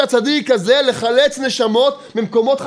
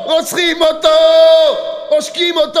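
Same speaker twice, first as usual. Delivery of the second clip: raised crying out, higher and pained; retold cry; 4 semitones higher, 3 dB louder than usual. King David crying out to God, raised voice. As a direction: cry out like one being murdered. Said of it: "הוא צועק".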